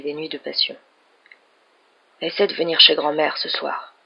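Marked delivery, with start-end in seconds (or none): none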